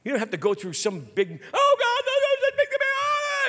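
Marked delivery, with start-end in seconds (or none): 1.5-3.5 very high, loud hammed-up plea; mock desperate wailing. Preacher mocking a dramatic plea to God, hammed up. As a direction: overblown prayer wail, played for laughs. Mocks overblown, flailing way of praying.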